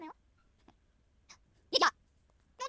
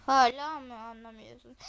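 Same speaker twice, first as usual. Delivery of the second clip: tearful lower sobbing question; crying; 3 semitones lower, 3 dB louder than usual. Acted. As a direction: cry it out, hurt and accusing. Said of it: "Ablacım ağlama". (ağlayarak sitem etme)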